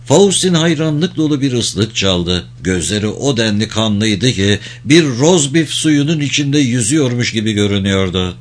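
ordinary delivery, nothing marked

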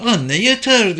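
put-on high-pitched, tearful little girl; mildly acted crying child. A narrator's reading. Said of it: "Madeleine ağlayarak".

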